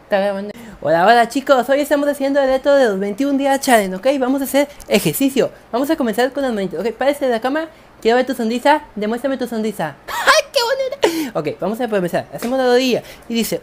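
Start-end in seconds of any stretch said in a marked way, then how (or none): 0.8-10.1 loud, upbeat workout-host voice; fitness host parody. Acted. Fake exercise host leading a challenge, loud and upbeat. 10.1-11.4 gushing praise. Acted.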